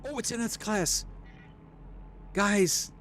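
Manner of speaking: high, giddy fangirl squeal; real high-pitched glee over a lucky find; schoolgirly excitement. Real, not acted. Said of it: "like, a little schoolgirly there".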